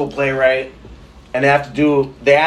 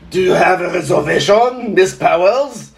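higher, hammed-up French waiter; bad French accent; 3 semitones higher, 4 dB louder than usual. Shown as a demonstration. Waiter asks Miss Powers about reservation, bad French accent. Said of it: "could do a bad French accent".

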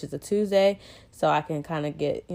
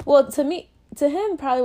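sudden high correction; 9 semitones higher, 5 dB louder than usual. Real, not acted. Correcting herself mid-thought.

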